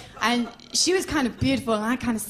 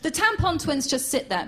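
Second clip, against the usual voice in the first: ordinary talk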